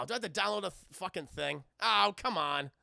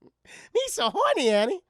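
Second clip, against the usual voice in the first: hammed-up Jar Jar squeal; 10 semitones higher, 10 dB louder than usual. Streamer hams up Jar Jar reading fan fiction, squealing. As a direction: shriek it like a cartoon alien.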